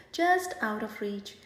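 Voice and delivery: ordinary delivery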